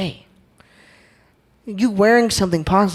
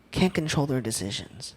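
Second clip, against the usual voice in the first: much softer, repeating it to himself; quiet echo; 3 semitones lower, 10 dB quieter than usual. Real, not acted. Speaker echoing his point to the crowd, softly. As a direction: let the repeat drop almost to a murmur.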